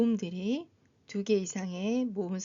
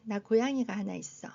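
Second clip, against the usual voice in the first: ordinary talk, nothing marked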